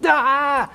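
high, sudden mock-demon "ah"; put-on cry meant to startle; desperate scare cry. Demon about to lose somebody, desperate scare cry. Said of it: "out of desperation, they're like".

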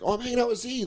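high, soft imitation of her; breezy mock girlfriend. Girlfriend excusing herself on the phone, breezy high imitation.

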